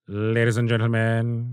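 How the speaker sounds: slow, drawn-out film villain impression; Alan Rickman. Showing a co-host a favourite film line, drawling villain impression. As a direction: stretch it out slowly, savouring each word. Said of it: "the way Alan Rickman says".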